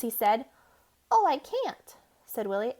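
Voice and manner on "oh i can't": squeaky toy mouse, pitched up; the little toy's cheerful refusal; high wind-up mouse voice. Acted. Willie voiced as character.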